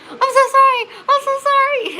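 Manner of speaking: high, loud mock wail; frantic apology. Mother in labour apologising after screaming in someone's face, frantic. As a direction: cry out a panicked apology, re-enacted. Apologising to crying child mid-contraction.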